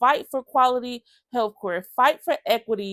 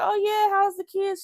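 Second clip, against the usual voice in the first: higher, bubbly small-talk voice; chirpy social worker; 11 semitones higher than usual. Retold or imitated. Social worker chatting her up, bright and much higher.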